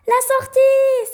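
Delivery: very high, loud, drawn-out cry of relief; slow, jubilant exclamation; joyful shout. Acted. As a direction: shout it out at the sight of daylight. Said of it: "s'écrièrent-ils en chœur".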